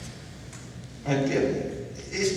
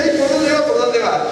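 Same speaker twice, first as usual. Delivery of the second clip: higher, louder confused cry; a worshipper lost in the prayer, calling out; Ay por dónde va blurted high and loud; lost congregant; 7 semitones higher, 4 dB louder than usual. Retold or imitated. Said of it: "la gente dice".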